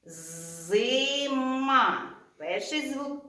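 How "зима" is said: «Зима» stretched out slowly, each sound drawn long for the class; drawing out «Зима» so children hear every sound; teacher stretching «Зима» for sound analysis; slow spelling-out. Shown as a demonstration. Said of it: "Промовляємо слово".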